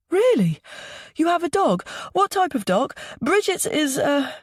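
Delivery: put-on excited little boy, pitched very high; small boy's high voice. A narrator's reading. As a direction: fire questions in a high child's voice. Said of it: "Milo's high voice".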